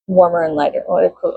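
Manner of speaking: ordinary delivery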